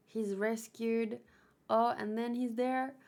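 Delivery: usual speaking voice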